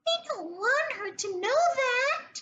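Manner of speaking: high, quick, hushed gnome voice; tiny voice. A narrator's reading. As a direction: rush it out under your breath like a tiny gnome. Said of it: "said a third tiny voice".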